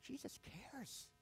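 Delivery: soft, high and tender. Real, not acted. Pastor telling listeners Jesus cares, soft and tender. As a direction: hush and lift it like a confidence. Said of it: "tender sermon point".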